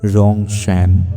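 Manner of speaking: ordinary delivery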